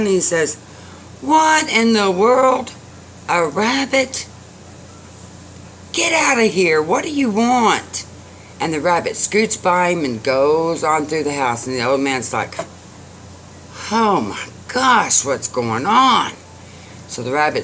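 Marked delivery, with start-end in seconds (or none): 1.2-8.1 put-on old man's exasperated outburst, voice raised high; startled indignation. A narrator's reading. Old man finds a rabbit at the door, indignant. 13.9-16.3 high start, slow and drawn out, dropping; bewildered exclamation. A narrator's reading. Old man as rabbit scoots through house, bewildered.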